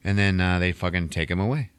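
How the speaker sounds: usual speaking voice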